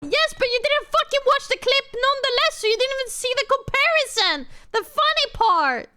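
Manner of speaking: high, heated insistence; indignant protest, voice pushed high; exasperated retort. Real, not acted. Arguing back about the unwatched clip.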